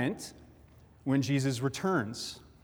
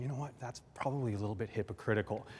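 softer, quicker thinking to himself; the atheist thinks to himself, softer and hurried; inner thought; 4 semitones lower, 11 dB quieter, faster than usual. Acted. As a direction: muse it under your breath. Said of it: "The guy's sitting there thinking".

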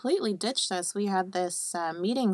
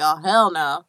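mock mad; 4 semitones lower, 7 dB louder than usual. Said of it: "Oh, now she's mad".

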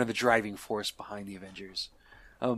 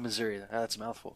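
normal talking voice

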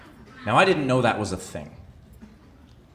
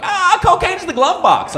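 much higher, loud and slow mock breakdown; frazzled driver; 9 dB louder than usual. A rattled driver cracking under the cop's wait, high and slow. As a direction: blurt the confession in a strung-out squeal. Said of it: "you're like".

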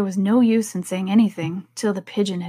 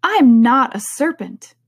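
louder, higher, drawn-out denial; offended Alice; indignant; 4 semitones higher, 6 dB louder than usual. A narrator's reading. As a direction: protest it slowly with firm indignation. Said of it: "said Alice indignantly".

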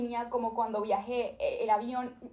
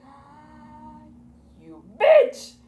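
angry insult; 6 semitones higher, 15 dB louder, much slower than usual. Retold or imitated. Fed-up traveller cursing the agent, loud snap. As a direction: spit out the insult.

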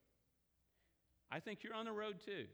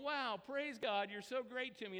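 acted delighted praise; 7 semitones higher, 4 dB louder than usual. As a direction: gush thanks as if everything goes your way. Acting out delight at green lights.

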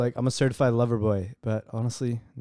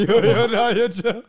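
higher and louder, laughing through a mock panicked "chill"; laughing protest; 8 semitones higher, 7 dB louder, slower than usual. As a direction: laugh while waving off the joke. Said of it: "I was like".

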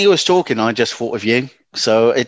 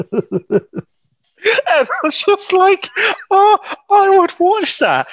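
cracking up mid-sentence, pitch shooting up; laughing hard. Real, not acted. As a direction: speak through a fit of giggles. Cracking up over Shrek image.

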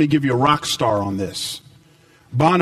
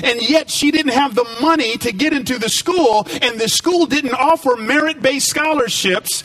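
raised, ringing preacher voice; full-force preaching; 9 semitones higher than usual. Acted. Preacher telling the church about a scholarship, at full force. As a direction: build it up like a revival sermon.

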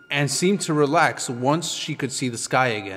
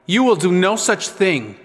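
higher, louder, firm objection; raised, forceful refusal; indignant retort; 6 semitones higher, 5 dB louder than usual. A narrator's reading. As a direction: shut the threat down indignantly. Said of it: "glaring at Grop".

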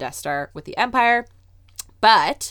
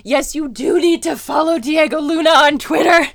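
excited agreement; 9 semitones higher, 8 dB louder than usual. Real, not acted. Emphatic agreement with co-host.

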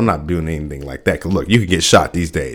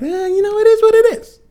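mock high falsetto, couldn't-care-less; shrugging them off. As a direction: squeak it out like a careless shrug. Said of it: "they're like".

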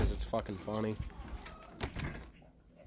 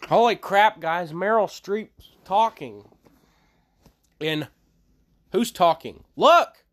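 loud, high excited exclamations; mock amazement; 8 semitones higher, 9 dB louder than usual. Alerting the guys to Meryl Streep talking, loudly amazed. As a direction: shout it out like astonishing news.

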